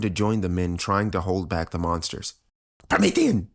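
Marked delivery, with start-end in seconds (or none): 2.9-3.5 high-pitched frantic cry; piercing, desperate call; mother's screech. A narrator's reading. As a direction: screech his name to rouse him. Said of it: "she screeched".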